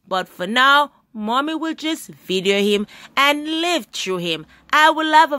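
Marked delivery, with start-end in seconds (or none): none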